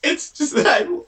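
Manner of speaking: high, crying voice; acted crying. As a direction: sob through the words. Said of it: "why is your mask boy crying".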